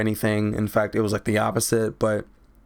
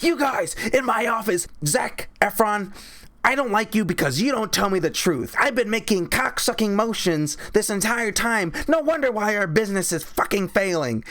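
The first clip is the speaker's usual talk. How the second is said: much higher put-on boss voice; hammed-up angry boss, voice pitched up; boss scolding; 8 semitones higher than usual. William H. Macy scolding the guys in his office, high put-on voice.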